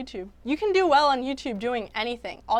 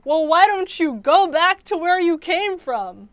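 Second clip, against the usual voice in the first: higher, louder snide waitress; rude waitress; 5 semitones higher, 6 dB louder than usual. Retold or imitated. Vlogger voicing the waitress in Terrence's video, mildly snide. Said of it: "the waitress said".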